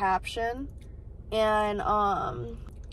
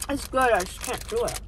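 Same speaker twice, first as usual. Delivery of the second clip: eating sour candy. Real, not acted. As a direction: mouth full of taffy. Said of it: "taking so goddamn long to chew".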